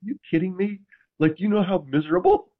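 mock outrage to the coach, laughing; incredulous disbelief. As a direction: retell the shock of hearing it, pitch up. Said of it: "And I was like".

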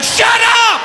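sudden high-pitched yell; exasperated snap. Acted. Dad yells at his questioning eight-year-old, exasperated. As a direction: explode to silence a nagging child. Snapping at son's repeated questions.

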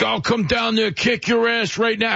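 high-pitched mock threat in a gravelly voice; hammed-up gravelly threat, pitched high; Tom Homan. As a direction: imitate a blustering tough guy. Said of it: "your gravelly, ridiculous voice".

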